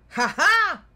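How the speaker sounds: loud, high "ha ha"; sudden loud spoken laugh; mocking laugh. A narrator's reading. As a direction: crow a big triumphant fake laugh. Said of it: "And Kling Kling said".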